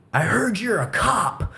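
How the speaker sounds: lightly voiced suspicious neighbor; accusing neighbor. Neighbor confronting the teller, mock accusing. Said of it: "and he's like".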